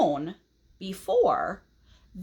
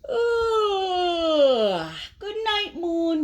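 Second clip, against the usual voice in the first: yawning; 9 semitones higher, 4 dB louder, much slower than usual. A narrator's reading. Sleepy Max yawns his goodnight to the moon. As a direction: yawn through the words. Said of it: "yawns Max".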